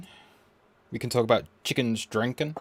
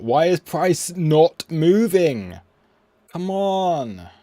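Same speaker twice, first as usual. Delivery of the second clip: high, loud, drawn-out exasperation; real frustrated whine at a stalled screen; exasperated plea; 6 semitones higher, 6 dB louder, much slower than usual. Real, not acted. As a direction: plead in frustration, stretching it out. Exasperated at a frozen market.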